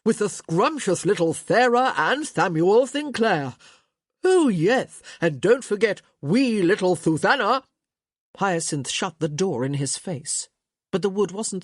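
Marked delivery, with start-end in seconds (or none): 0.0-7.6 louder, teasing singsong; laughing tease. A narrator's reading. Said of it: "Gregory laughed".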